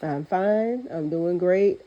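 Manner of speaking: ordinary talk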